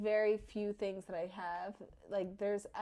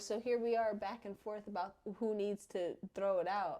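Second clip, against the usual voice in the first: ordinary talk, nothing marked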